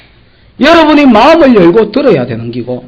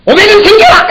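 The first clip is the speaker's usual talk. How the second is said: much higher and louder, a hammed-up "오백 원 생겨라!" shout; mock miracle command; 13 semitones higher, 6 dB louder than usual. Preacher jokingly commanding coins from the pulpit, loud and high. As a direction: pretend to summon a coin with a booming shout.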